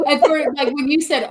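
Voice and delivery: usual speaking voice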